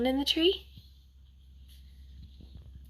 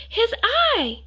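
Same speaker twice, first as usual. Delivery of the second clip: high, loud excited shout; 11 semitones higher, 4 dB louder than usual. A narrator's reading. Boy pointing from the mountaintop, high loud shout. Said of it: "he shouted".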